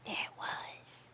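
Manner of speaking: whispered, slow storytelling hush; spooky whisper. Pretend. Streamer opening a spooky story, whispering. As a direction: whisper it like a campfire ghost story. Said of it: "I'll start telling spookies".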